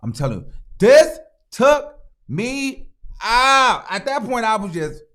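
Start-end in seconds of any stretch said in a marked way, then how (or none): 0.8-3.8 THIS TOOK ME OUT, each word punched high and apart; slow, loud, high emphasis on every word of "this took me out"; emphatic outburst. Real, not acted. Emphatic retelling of what upset her.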